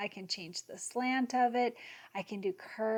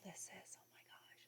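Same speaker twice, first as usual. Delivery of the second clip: excited whisper, fast; real delighted whisper; 27 dB quieter, faster than usual. Real, not acted. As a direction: breathe it out in a rush of delight. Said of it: "Sorry, I'm whispering".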